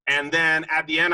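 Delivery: normal talking voice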